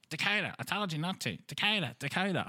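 put-on mother scolding her child, high and nagging; nagging mum. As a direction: call the child's name like a fed-up mother. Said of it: "she said it".

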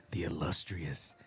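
drawn-out, hushed, low announcer; slow dramatic announcer. Acted. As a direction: stretch the name out for drama. Slow hyped name reveal.

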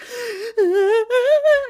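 loud, high gasping wail; imitated frightened crying; mock baby cry. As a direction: imitate a startled baby bursting into sobs. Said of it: "he just started crying".

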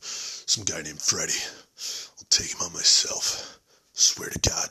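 low, slow, breathy mutter; mock drunk on the porch muttering; brooding drunk quote. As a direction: growl it to yourself like a vow. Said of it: "He's like".

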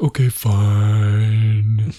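drawn-out, very slow sulky okay; grudging okay. Retold or imitated. Girls giving in to dad on radio, sulky and slow. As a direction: drag it out like a reluctant kid. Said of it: "And they're like".

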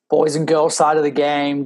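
ordinary delivery

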